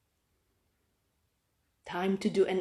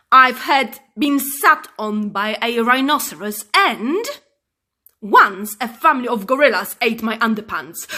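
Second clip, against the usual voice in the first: loud angry telling-off; loud indignant rant; furious presenter; 8 dB louder than usual. Acted. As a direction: scold the cub loudly. Furious man scolds cub.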